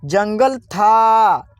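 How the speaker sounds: जंगल था stretched very slowly; slowly drawn out. Shown as a demonstration. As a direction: sound out जंगल था slowly, syllable by syllable. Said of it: "क्या होगा?". (जंगल था धीरे-धीरे खींचकर बोलें)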